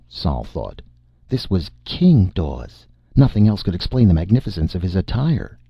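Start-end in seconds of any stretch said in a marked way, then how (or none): none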